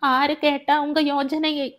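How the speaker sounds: high-pitched protest of a wounded woman; acted outraged refusal, pitched high throughout; distraught, indignant Tulasi. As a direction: voice her refusal high, at ordinary volume. Tulasi retorts indignantly in the story.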